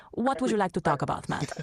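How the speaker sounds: put-on a light Spanish accent; mild Spanish accent, softly spoken. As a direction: ask it with a hint of Spanish accent. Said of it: "switching to a Spanish accent".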